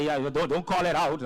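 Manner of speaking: usual speaking voice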